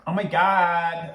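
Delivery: slow, drawn-out American accent; hammed-up American exclamation; mock American accent. Shown as a demonstration. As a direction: stretch it in an exaggerated American accent. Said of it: "what do American people say".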